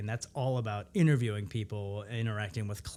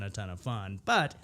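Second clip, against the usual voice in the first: normal talking voice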